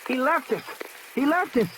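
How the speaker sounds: high, slowing wail of panic; screaming child. Acted. Lex screams after the man who left them. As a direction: wail it like a terrified little girl. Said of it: "she had started to scream".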